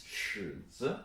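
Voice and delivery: "尺子" with a drawn-out dipping 尺; third-tone demo. Shown as a demonstration. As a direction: model "尺子" slowly, third tone on 尺. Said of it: "the third one is".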